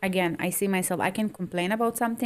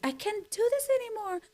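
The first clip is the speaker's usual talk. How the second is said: high, far whiny wail of self-pity; mock despairing whine, pitched high; victimized whining; 13 semitones higher than usual. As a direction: whine like the world is ending. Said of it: "Like, I'm victimized".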